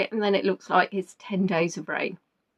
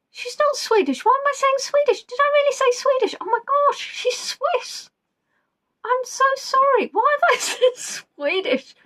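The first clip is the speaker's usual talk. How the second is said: much higher, squeaky, laughing at her own mistake; mortified squeal; 14 semitones higher than usual. Real, not acted. Quiz host to her players, apologising for her blunder in a high giggly squeak.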